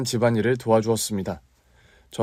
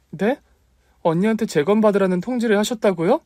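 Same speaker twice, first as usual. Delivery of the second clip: high, louder disbelieving cry; stunned question; 11 semitones higher, 4 dB louder than usual. Acted. Aunt asks the doctor again, high and shocked.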